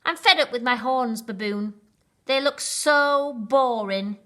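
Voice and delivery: a rhino moaning about his dull horns; complaining. A narrator's reading. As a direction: grumble it like a sulky rhino. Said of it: "complained Rhino".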